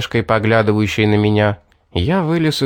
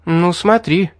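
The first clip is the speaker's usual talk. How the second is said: угрожающе; 8 semitones higher than usual. A narrator's reading. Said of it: "пригрозила она".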